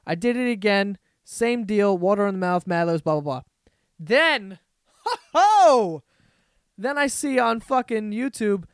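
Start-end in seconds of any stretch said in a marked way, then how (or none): 5.0-6.0 hearty high laugh; loud laugh. Real, not acted. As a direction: burst out laughing. Laughing at the copycat video.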